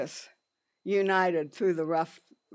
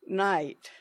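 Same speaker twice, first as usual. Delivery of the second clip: ordinary delivery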